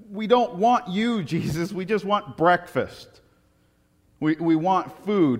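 high, loud, slow demanding crowd voice; whiny demanding crowd. Acted. The crowd telling Jesus they only want food, in a whiny high demand. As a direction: play the crowd whining for breakfast.

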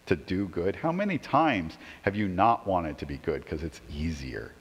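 ordinary delivery

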